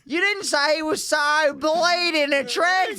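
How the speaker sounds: very high, drawn-out wailing shriek; hammed-up swooning yell, stretched slow; girlfriend impression. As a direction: screech it as an over-the-top girlfriend. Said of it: "That's what she said".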